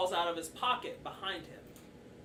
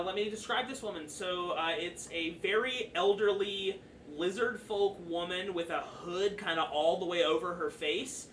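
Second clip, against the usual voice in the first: ordinary talk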